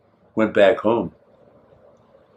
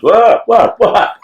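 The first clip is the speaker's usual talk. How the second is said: high, loud startled imitation; imitating a man woken abruptly; startled awake; 10 semitones higher, 4 dB louder, slower than usual. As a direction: jolt awake with rapid questions. Voicing husband woken with a start.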